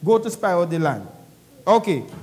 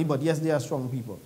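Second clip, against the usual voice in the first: lower, softer passing concession; 6 semitones lower, 5 dB quieter than usual. Shown as a demonstration. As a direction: drop it in as an afterthought. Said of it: "That's just a by the way".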